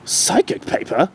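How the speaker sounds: slow surprised question; the Doctor taken aback. Acted. The Doctor surprised at Future Derpy's words. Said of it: "The Doctor was surprised".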